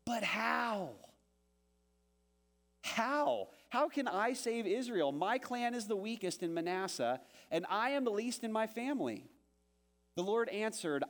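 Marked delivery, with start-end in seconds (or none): 0.0-9.3 pleading Gideon. Acted. Pastor voices Gideon asking how.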